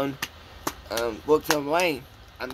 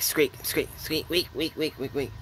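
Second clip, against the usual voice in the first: guinea pig squeaks; 4 dB quieter than usual. Retold or imitated. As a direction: run of imitated "squeak, wheek" noises. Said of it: "Sounds like breaking a guinea pig".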